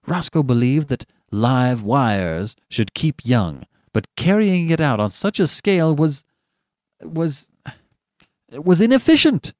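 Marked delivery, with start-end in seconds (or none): 3.9-9.5 hesitant, then loud high burst; exasperated groping. A narrator's reading. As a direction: grope for the word, then burst out. Roscoe groping for his favorite word.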